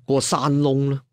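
plain reading voice

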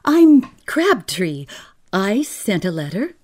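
high, loud nervous announcement; shrilly blurted self-introduction; shrill. A narrator's reading. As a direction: start shrill and loud, easing off by the end.

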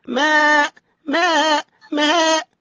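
high-pitched ewe's "maa"; sheep bleat. Shown as a demonstration. Teacher shows thin mim like a ewe, high bleat. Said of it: "زي كده النعجة".

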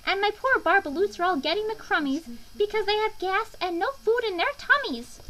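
high, loud, scolding creature voice; snapping Lorax. A narrator's reading. Lorax confronting the Onceler over felled trees, snapping. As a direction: scold indignantly, pitched well up. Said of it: "He snapped".

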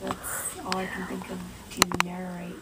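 hushed whisper. Real, not acted. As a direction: whisper so nobody hears. Whispering, roommate talking.

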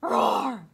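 loud playful roar; dinosaur roar. Pretend. Dinosaur roars at bus driver.

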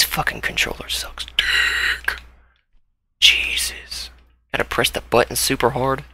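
quietly cursing the controller; frustrated whisper. Real, not acted. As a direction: hiss it under your breath. Suddenly whispering to himself.

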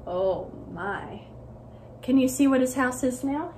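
usual speaking voice

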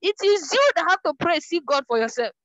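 imitating angry rebuke. Preacher imitating Dada Heli scolding her for asking for prayer, mildly heated. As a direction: retell the friend's sharp rebuke with some heat. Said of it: "she got angry one day".

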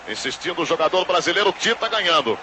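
usual speaking voice

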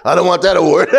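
high laugh through the words; real laughter. Real, not acted. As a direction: laugh out the line. Laughing at own joke.

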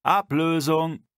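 high, loud, drawn-out call; a guard calling the relief shift, loud and slow. Acted. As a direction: stretch the word out as a shout down the tunnel.

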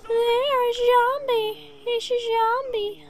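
high, slow cry of zombie; mock villager alarm. Zombie gamer mimics scared humans, high and slow. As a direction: play a panicked villager spotting a zombie.

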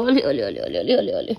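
low playful ole ole chant. Real, not acted. Cooing to toddler. (बच्चे को बहलाते हुए)

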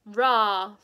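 loud full roar from the chest; lion roar. Acted. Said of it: "roared Aslan".

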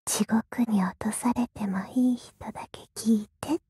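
low breathy whisper right in the ear. Acted. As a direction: whisper it close to the ear, unvoiced. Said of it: "悪魔が耳元でささやいてあげる".

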